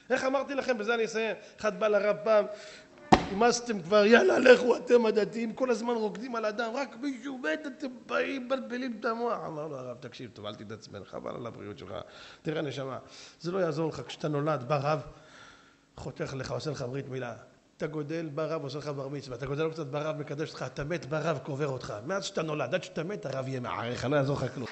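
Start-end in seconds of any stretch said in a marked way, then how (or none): 3.3-9.4 higher, scornful nagging; exasperated complaint. Retold or imitated. Secular man complains to rabbi. 10.0-24.7 calm reassurance. Retold or imitated. Rabbi answers calmly.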